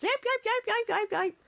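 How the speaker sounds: cartoon bear yelps. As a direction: yelp like a bear fleeing in a cartoon. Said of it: "it's like in the cartoons".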